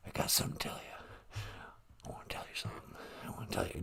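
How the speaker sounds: conspiratorial stage whisper; Joe Biden. Acted. President confiding in the radio host, whispered. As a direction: drop right down into a whisper. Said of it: "you're whispering?".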